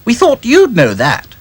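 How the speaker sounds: high bright doll voice; Dotty's voice. Acted. Doll answering the dog, pert. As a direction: answer lightly, a bit pert.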